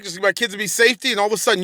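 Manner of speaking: ordinary delivery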